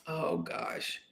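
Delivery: breathy aside whisper, unvoiced; a real whispered sigh of frustration; whispered to herself. Real, not acted. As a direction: mutter it under your breath, lost for the name. Searching for a forgotten name.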